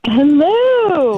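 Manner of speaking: high, drawn-out sing-song hello; calling hello. Real, not acted. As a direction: call out brightly on coming back. Calling out on returning to the call.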